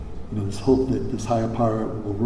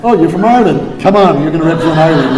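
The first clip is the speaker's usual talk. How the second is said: loud, higher, cheery mock invitation; breezily rope him in; a hammed-up pushy recruiter; mock casual drafting; 5 semitones higher, 8 dB louder than usual. Mocking old election habit.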